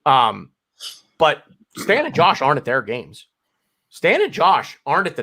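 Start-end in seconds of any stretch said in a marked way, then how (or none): none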